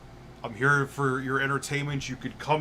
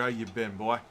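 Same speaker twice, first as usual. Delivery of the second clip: put-on old Southern man; 3 semitones lower than usual. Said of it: "talking like an old Southern man".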